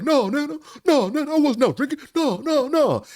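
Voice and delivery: high, loud whiny denial; imitating Uncle Sammy denying. Uncle denying to grandma that he was drinking, high and whiny. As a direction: protest your innocence to your mother. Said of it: "He's like".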